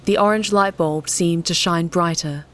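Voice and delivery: ordinary delivery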